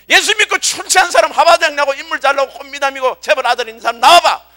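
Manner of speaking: acted, pushed challenger's shout; Goliath's challenge. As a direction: throw a taunting dare at the crowd. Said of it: "골리앗은 말이야".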